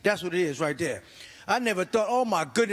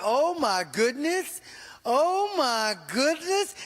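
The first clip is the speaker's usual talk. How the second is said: slow, higher gasping oh my goodness; drawn-out appalled reaction; mock shock; 6 semitones higher, much slower than usual. Seeing ugly baby.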